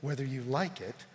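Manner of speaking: low, soft, measured declaration; solemn preaching. Acted. Preacher declaring Jesus Lord of all, solemn preaching. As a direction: state it gravely, with weighty pauses.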